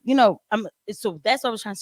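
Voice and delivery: ordinary talk, nothing marked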